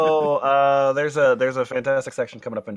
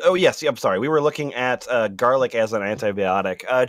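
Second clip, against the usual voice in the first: ordinary talk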